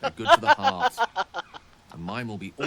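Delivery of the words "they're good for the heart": laughing through the line. Acted. Wizard laughing as he sends the hero off to the party. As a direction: chuckle it out, amused and fond.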